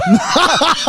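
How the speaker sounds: high bright laugh at his own joke; burst of laughter. Real, not acted. Laughing at his own joke to his co-host. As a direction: laugh out at the car finance gag.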